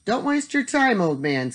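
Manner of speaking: put-on high-pitched sneer, dropping on "old man"; a scornful put-down, falling at the end. A narrator's reading. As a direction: sneer it dismissively, starting high. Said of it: "sneered Tom".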